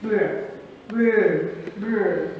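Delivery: "brr" as a separate low blend sound; consonant blend demo. Shown as a demonstration. Teacher showing how to pronounce blends, low isolated "brr". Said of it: "that's how you're supposed to pronounce".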